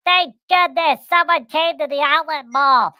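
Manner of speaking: high, loud, relieved gushing; relieved groundhogs. Retold or imitated. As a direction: groundhog shopkeepers overjoyed to see a customer. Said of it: "all the groundhogs ... were like".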